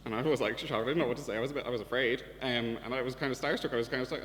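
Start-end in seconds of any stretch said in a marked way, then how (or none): none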